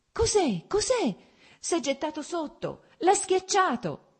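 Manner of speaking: high, loud panicked cries; alarmed crowd cries. Acted. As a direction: shout out in shock at the accident.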